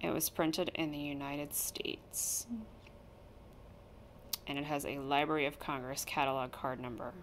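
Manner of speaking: usual speaking voice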